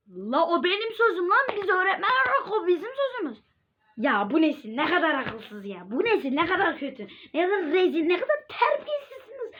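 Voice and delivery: high, scolding teacher voice; 1 ranting teacher. Pretend. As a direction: play a teacher berating lazy students. (öğretmen taklidi, azarlayan ses)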